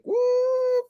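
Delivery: a sudden leap up high on what; shocked what. Retold or imitated. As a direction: blurt it out high, totally thrown. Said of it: "we were like".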